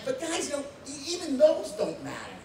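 a retold rant swelling to a shout; building excitement. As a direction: start urging and build to the breakthrough. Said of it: "I'm like".

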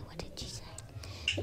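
breathy whispered question. Acted. Classmates ask each other, whispering. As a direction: lean in and whisper it. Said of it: "They all whisper".

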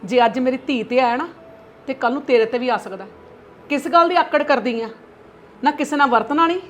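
angry lecturing mother. Acted. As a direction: scold her daughter hard. Mother lectures daughter angrily.

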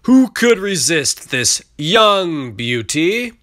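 loud, slow auctioneer pitch; auctioneer calling out. A narrator's reading. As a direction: sell it loudly to the crowd. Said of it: "the auctioneer's voice rose above the bubbling crowd".

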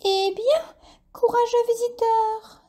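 very high, bright exclamation; exclaiming bat. A narrator's reading. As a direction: call out in surprise to the fainted lizard. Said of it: "s'exclama Dolly la chauve-souris".

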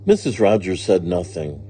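usual speaking voice

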